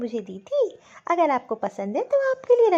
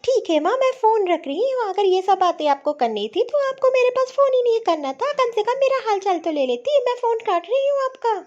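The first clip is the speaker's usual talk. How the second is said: higher, louder indignant scolding; angry; 6 semitones higher, 5 dB louder than usual. Acted. Daughter-in-law hanging up on her doubting mother, angry. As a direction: cut mother off in a raised, angry voice. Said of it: "इतना गुस्सा किस चीज़ पे हो".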